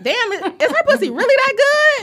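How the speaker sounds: high, laughing disbelief; mock incredulous question; amazed questioning. Acting out a curious lover's thought.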